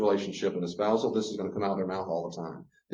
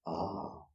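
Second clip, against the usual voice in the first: relieved sigh of calm; 4 semitones lower, 12 dB quieter than usual. Retold or imitated.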